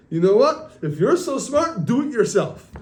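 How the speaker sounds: storyteller's indignant challenge; fed-up outburst. Retold or imitated. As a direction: act out throwing the job back at someone. Retold fed-up outburst in shul.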